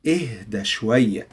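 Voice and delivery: "إهدى شوية" with a chesty audible H; H from the chest. Shown as a demonstration. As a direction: stress the h in "إهدى", taking "شوية" down softly. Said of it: "it comes from your chest".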